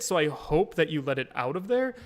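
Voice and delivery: ordinary delivery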